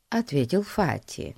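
ordinary delivery

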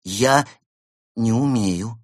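halting, embarrassed admission; abashed. A narrator's reading. Penguin confessing to the panda he can't, abashed. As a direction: break after the first word, a little sheepish. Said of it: "сконфузился Евгений".

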